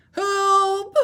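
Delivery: high, loud call for help; Baby Seal calling out. Shown as a demonstration. Teacher voicing Baby Seal calling for help. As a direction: shout into the storm. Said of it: "show you how to use voices".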